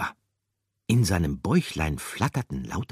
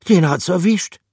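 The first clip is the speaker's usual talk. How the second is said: very high, loud animal voice; blunt verdict on the poor mouse; character voice; 14 semitones higher, 12 dB louder than usual. Acted.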